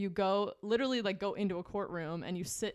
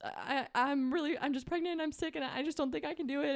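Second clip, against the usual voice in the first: mock weepy plea, higher pitch; retold tearful plea; 8 semitones higher than usual. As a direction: imitate a high, overwhelmed tearful confession. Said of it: "I was just crying".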